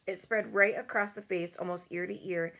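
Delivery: usual speaking voice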